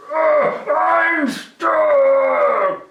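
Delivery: high, loud panicked goblin shout; stuck and yelling. Acted. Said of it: "the goblin shouted".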